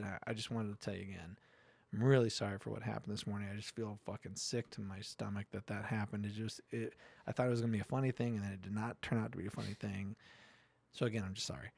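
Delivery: quiet, contrite apology, voice dropped low; a guy murmuring how sorry he is; hushed apology. Retold or imitated. Coworker apologizing on the phone, quiet and contrite.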